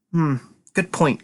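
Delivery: slow, musing good point; thoughtful. Acted. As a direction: weigh the idea slowly. Mulling it over, repeats.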